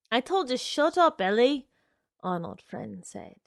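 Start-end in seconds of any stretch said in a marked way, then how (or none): 0.1-1.7 angry. A narrator's reading. Arnold snaps at Ellie.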